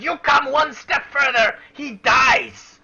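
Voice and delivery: high, loud goblin hostage threat; threatening goblin. Acted. Goblin holding a knife to a hostage warns the party, high threatening shout. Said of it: "holds this human's throat and he says".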